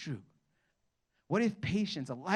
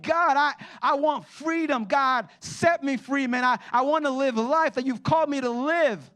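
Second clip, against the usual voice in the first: pleading prayer voiced; 9 semitones higher, 7 dB louder than usual. Pastor voicing believers praying for freedom, high and pleading. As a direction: beg God for freedom while clinging on.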